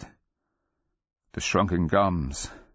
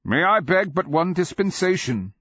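crying out; 12 semitones higher, 4 dB louder than usual. A narrator's reading. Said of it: "a Kamian cried".

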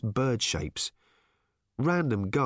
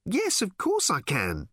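high, slow, emphatic answer; impatient reply; 8 semitones higher than usual. A narrator's reading. Random assuring the bird she hears, high and emphatic.

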